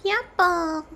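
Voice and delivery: high, silly nonsense voice; silly squeak. Pretend. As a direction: blurt it out in a funny high voice. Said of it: "I don't know what that was".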